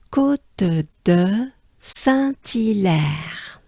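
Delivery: "Côte de Saint-Hilaire" at half speed, French r; French pronunciation demo. Shown as a demonstration. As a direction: stretch every syllable of "Côte de Saint-Hilaire". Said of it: "One more time".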